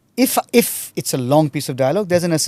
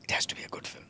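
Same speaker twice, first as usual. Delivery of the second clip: whispering; 3 semitones higher, 3 dB quieter than usual. Real, not acted. Repeats emphatically, dropping to whisper.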